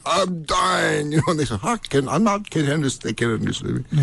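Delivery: comic plea from a sickbed; mock desperation. Sick guest phoning the hotel desk, mock desperate. Retold sick phone call, not understood.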